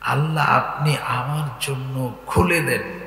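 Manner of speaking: slow, deliberate phrase-by-phrase dua; attentive recitation. Shown as a demonstration. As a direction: dwell on each phrase as you translate. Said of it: "খেয়াল করো". (মনোযোগ দিয়ে ধীরে পাঠ)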